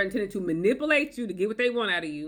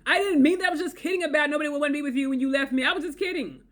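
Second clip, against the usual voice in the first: much higher, singsong fake innocence; mock innocent kidding; 7 semitones higher than usual. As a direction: play it off as a joke, high and sweet. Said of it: "aggressive sarcasm is marked as humor".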